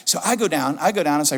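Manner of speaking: ordinary talk, nothing marked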